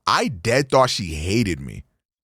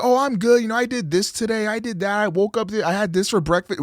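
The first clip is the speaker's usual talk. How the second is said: higher, fast, bubbly chatter for a typical friend; chatty oversharer; 9 semitones higher, faster than usual. Retold or imitated. Imitating a chatty friend who says too much about their day, bubbly and higher. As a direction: rattle off your day like an eager talker. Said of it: "if somebody says".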